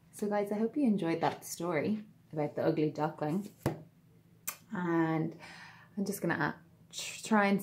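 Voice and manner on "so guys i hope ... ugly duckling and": plain reading voice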